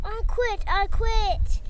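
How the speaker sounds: storybook shout of surrender. A narrator's reading. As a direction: call out in defeat over the noise. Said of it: "shouted Puck".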